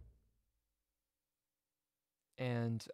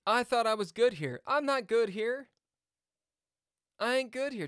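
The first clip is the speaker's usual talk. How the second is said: higher and louder with each line; rising alarm; 8 semitones higher, 7 dB louder than usual. Real, not acted. Streamer panics at his chess position, rising. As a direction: worry building as he sees it.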